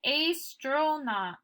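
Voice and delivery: slow "Astronaut" with a long A as in ace; mispronounced demo. Shown as a demonstration. Teacher sounding out astronaut for students, mispronounced with a long A. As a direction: say "Astronaut" as AYS-tro-naut, drawn out. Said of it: "we don't say astronaut".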